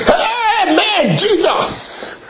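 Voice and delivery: high, drawn-out mock preacher's cry; hyped-up pastor. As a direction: imitate a pastor whipping up the church, stretching the words high. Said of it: "when the pastor says".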